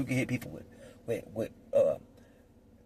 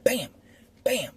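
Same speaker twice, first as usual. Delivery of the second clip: mock impact sounds; 11 semitones higher than usual. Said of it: "you beat 'em with it".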